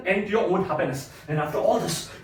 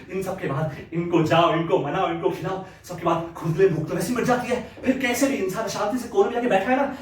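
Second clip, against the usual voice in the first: ordinary talk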